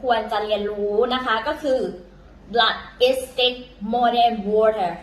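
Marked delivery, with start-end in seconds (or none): 2.5-4.9 very slow, word by word; slow careful English; slow English proverb. Shown as a demonstration. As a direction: spell out the proverb slowly for learners. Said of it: "อีกทีนึงนะคะ".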